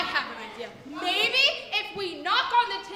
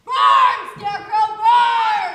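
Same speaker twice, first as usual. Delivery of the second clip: much louder screaming burn; witch's furious cry; 11 dB louder, slower than usual. Acted. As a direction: scream it with glee. Witch sets scarecrow alight.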